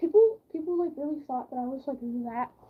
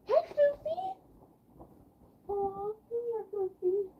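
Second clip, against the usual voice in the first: much higher, cooing pet voice; 7 semitones higher, slower than usual. Real, not acted. Greeting her dog Sophie, high cooing baby talk.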